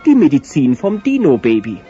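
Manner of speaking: usual speaking voice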